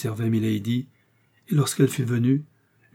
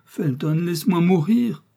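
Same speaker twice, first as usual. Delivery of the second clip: higher, louder entreaty; imploring, rising in intensity; desperate plea; 7 semitones higher, 4 dB louder than usual. A narrator's reading. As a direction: beg to be allowed death. Milady pleads to die.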